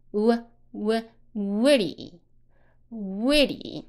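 isolated "W" sound, then a very slow "witty"; W sound drill. Shown as a demonstration. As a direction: round into the "W" and draw out "witty". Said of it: "W是W". (先单发“W”音，再放慢读“witty”)

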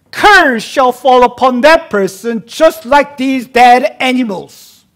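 rising to a loud, high preached vow; loud oath. Acted. Preacher booming the vow to the congregation, loud. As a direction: thunder the covenant curse.

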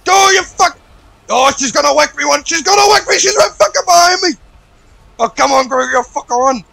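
high frantic yelling; panicked shout. Real, not acted. Player fleeing the crazy chicken lady, yelling in high panic. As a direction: scream at your partner to run.

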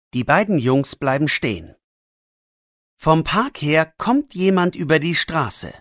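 plain reading voice

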